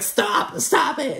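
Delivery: high-pitched mock plea; imitated distress. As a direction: imitate her begging, pitch shooting up. Imitating upset Alexia before crying.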